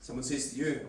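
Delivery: ordinary talk, nothing marked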